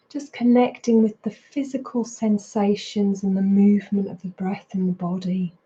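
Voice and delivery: unhurried meditation guidance with long pauses; guided meditation. Real, not acted. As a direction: guide them into body and breath, leaving space between phrases. Leads a mindfulness practice.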